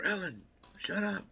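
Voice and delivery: high, loud, drawn-out yell; yelling at the dog. Retold or imitated. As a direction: holler a scolding out to the dog.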